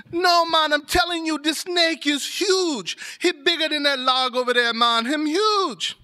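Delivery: high, emphatic arguing voice; high-pitched insistence, stretching the key word; acted insistent protest. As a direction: argue back in amazed insistence. Acted quarrel about the snake's size.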